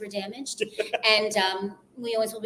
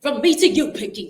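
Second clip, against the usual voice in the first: impression; 4 semitones higher, 6 dB louder than usual. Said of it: "I can, like, talk".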